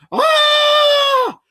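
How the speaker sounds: very high, loud shriek; pained shriek. A narrator's reading. Medusa shrieking when Grover hits her. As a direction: shriek as the blow lands. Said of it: "Medusa yelled".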